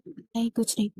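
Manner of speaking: a hint of held-back tears; choking back tears. A narrator's reading. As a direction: swallow the lump in the throat lightly. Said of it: "आंसुओं का गोला हलक में अटकता".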